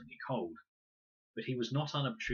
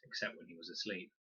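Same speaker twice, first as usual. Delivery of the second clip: plain reading voice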